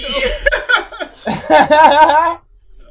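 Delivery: big shrieking burst of laughter; loud high laugh. Real, not acted. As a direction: howl with laughter at the ending. Laughing at own story.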